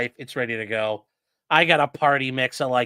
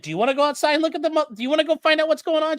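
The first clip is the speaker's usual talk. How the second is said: quick, much higher, needling questions; rapid sarcastic challenge; 11 semitones higher, much faster than usual. Retold or imitated. Challenging his partner during the break-in scare, quick and high-pitched. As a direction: fire it off fast and high to provoke her.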